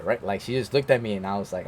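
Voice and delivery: normal talking voice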